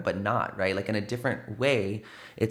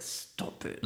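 hushed warning under breath; whispered stop it; 12 dB quieter than usual. Retold or imitated. Dad hushing his son at the party, whispered. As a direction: whisper it sharply to your son. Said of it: "my dad was like".

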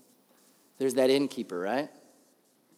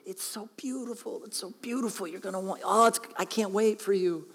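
gushing parents; 4 semitones higher, 4 dB quieter than usual. Retold or imitated. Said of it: "I'd heard all these stories".

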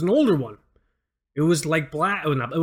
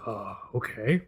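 meek okay; 7 semitones lower, 8 dB quieter, slower than usual. Retold or imitated. Scared coworker voiced.

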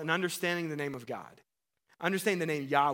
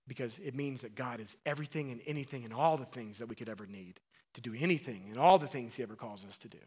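normal talking voice

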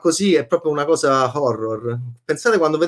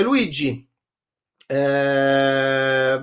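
normal talking voice

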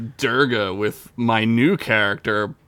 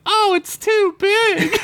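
mock swooning lady. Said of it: "even the holodeck ladies are like".

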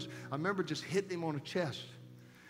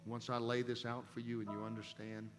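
tender voice of God; 6 semitones lower, 9 dB quieter than usual. Retold or imitated. Preacher retells God comforting him.

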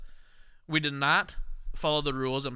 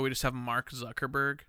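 usual speaking voice

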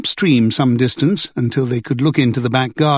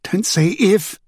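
begging; 6 semitones higher, slower than usual. A narrator's reading. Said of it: "Elaine begged".

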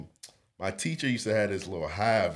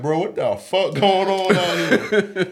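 high, loud, slower baffled outburst; confused; 8 semitones higher, 6 dB louder than usual. Retold or imitated. His younger self stuck in class, loudly baffled. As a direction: laugh through your own baffled question. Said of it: "I'm like".

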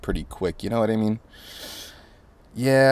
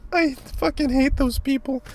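much higher character voice; put-on voice. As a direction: snap it in a silly shrill voice. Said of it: "sudden strange voice".

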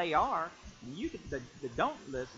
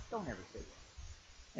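whispered, slow and grave; hushed warning; 5 semitones lower, 12 dB quieter, slower than usual. Real, not acted. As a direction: drop to a whisper to warn. Urgent hushed plea.

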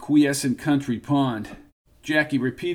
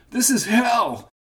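shout; 9 semitones higher than usual. Acted. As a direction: scream it out under fire. Soldier under fire cries out.

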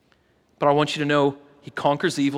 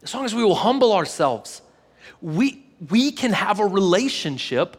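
preaching build; 5 semitones higher than usual. Real, not acted. Pastor preaching to congregation.

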